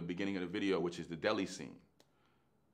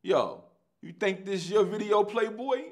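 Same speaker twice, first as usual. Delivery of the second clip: stern [name]; 10 semitones higher, 5 dB louder than usual. Retold or imitated. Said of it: "And he says".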